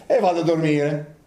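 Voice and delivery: lightly voiced jailer, pleased and chuckling; a hint of a self-satisfied guard, ticking off each lock; mild mock jailer, laughing as he settles to sleep; smug jailer. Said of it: "il carceriere ... ha detto".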